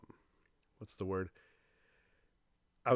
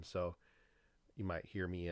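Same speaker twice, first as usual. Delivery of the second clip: normal talking voice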